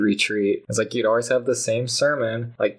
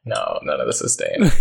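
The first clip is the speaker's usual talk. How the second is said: ordinary talk, nothing marked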